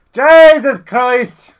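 loud, high, drawn-out cry of dismay; slow exasperated yell as he hits the floor; drunk exclamation. Acted. Drunk man falls on the floor, loud exclamation.

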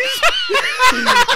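shouting laughter; roaring with laughter; loud laugh. Real, not acted.

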